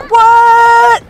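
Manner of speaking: high, loud disbelieving What; stunned by the news; shocked what. Real, not acted. Hearing a viewer ate the controller, loud shocked cry.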